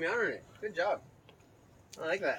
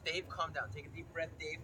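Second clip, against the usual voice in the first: quoting a calm doctor; 9 dB quieter than usual. Retold or imitated. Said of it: "She says".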